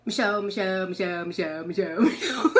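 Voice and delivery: a teasing chant of her own name, half laughing; nagging sing-song call. Retold or imitated. Her inner voice nagging her by name, a laughing sing-song. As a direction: call the name over and over like a pestering voice.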